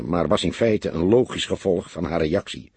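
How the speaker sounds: usual speaking voice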